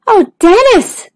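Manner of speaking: high, drawn-out reproach; gasped dismay. Acted. Stanley shocked at his cousin's bounce, dismayed gasp. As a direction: draw out the name in dismay. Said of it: "gasped Stanley".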